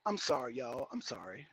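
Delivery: soft, low, embarrassed sorry; sheepish apology. Real, not acted. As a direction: trail off into a hushed apology. Apologizing after road rage outburst.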